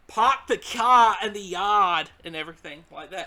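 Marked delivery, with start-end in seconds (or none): 0.1-2.1 put-on loud, slow, r-dropping Yankee accent. Showing how northerners talk, exaggerated Yankee accent. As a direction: draw out a hammed-up New England Yankee accent. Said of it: "with the Yankee".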